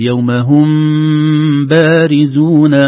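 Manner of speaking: "هم بارزون" with the mim hidden and nasal before the ba, very slow and loud; labial hiding. Shown as a demonstration. Teacher reciting slowly to show ikhfa shafawi. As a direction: hum the mim into the ba. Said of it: "نقول".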